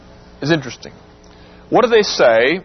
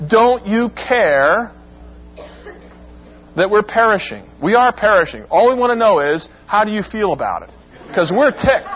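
raised, ticked-off complaint to Jesus, pitched high throughout; angry accusation; 5 semitones higher than usual. Shown as a demonstration. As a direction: sound fed up and accusing, like they're ticked. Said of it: "That's almost the way it sounds".

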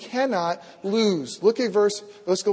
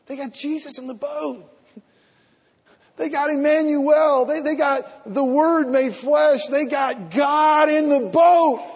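rising preacher shout; 7 semitones higher, 4 dB louder than usual. Real, not acted. Pastor building to a shout that God is in the boat. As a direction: build each claim louder until the last rings out.

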